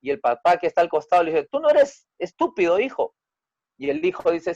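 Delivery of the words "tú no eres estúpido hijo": reassuring father. Acted. Father reassures son, acted.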